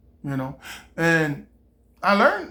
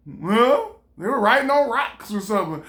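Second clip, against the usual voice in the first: ordinary talk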